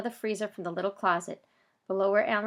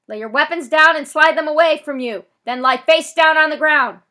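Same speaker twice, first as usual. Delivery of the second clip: loudspeaker orders; 8 semitones higher, 16 dB louder than usual. Acted. Police ordering the boys down over the megaphone. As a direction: bark the orders across the lot.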